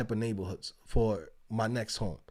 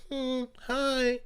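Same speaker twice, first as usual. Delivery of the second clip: a barely there greeting, pitched up; imitating a stiff neighbor who says hi and keeps walking; mumbled neighbor hi; 10 semitones higher, 4 dB quieter than usual. Neighbors passing with strollers, mock curt hi. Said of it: "and they just go".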